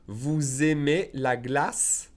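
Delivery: "Vous aimez" linked with a Z, said slowly; slow careful question; slow, with liaison. Shown as a demonstration. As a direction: drag it out, joining the S to "aimez". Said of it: "I'm making the liaison".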